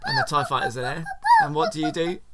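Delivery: very high, loud, fast and laughing; giggly high excitement; excited laughing. Real, not acted. Playing the game with child.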